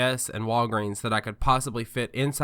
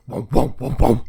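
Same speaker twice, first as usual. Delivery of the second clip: bouncing thuds over train tracks; imitated train-track bumps; 6 dB louder than usual. Likening the episode to train tracks, imitated bumping noise. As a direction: mimic the car bumping over rails. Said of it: "it's still like".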